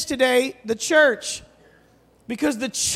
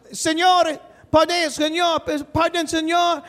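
put-on higher childlike calling, slow and pleading; little kid calling; 6 semitones higher, slower than usual. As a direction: tug at the preacher like a small boy. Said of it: "this little tiny kid in the back goes".

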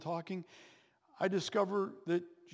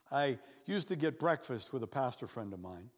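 ordinary talk

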